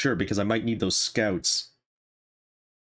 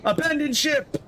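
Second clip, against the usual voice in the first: high shouted alarm; shouting. Acted. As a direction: yell it like an all-caps order. Reads all-caps shout.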